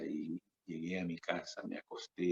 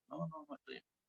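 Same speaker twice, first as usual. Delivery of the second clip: much higher, softer, quick repeated no; high denial; 7 dB quieter, much faster than usual. Retold or imitated. Scene: sister insisting to her brother she is not ill, high. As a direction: brush off the worry in a high voice.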